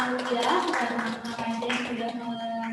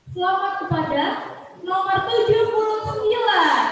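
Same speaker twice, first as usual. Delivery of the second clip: booming prize announcer; 8 semitones higher, 10 dB louder than usual. Real, not acted. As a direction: boom out the winning number. MC announces the winner.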